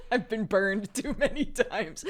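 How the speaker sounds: words broken up by real laughter; laughing. Real, not acted. Refusing chat's Scottish accent request, laughing. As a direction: laugh through the admission.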